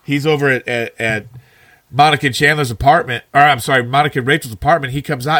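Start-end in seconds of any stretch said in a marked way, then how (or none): none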